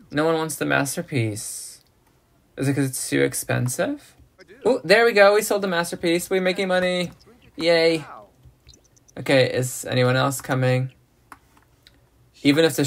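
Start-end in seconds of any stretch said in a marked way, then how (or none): 4.6-8.1 high-pitched, delighted celebration; excited cheer. Real, not acted. Gamer sees the masterpiece sell, excited cheer. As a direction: celebrate the sale in a raised pitch.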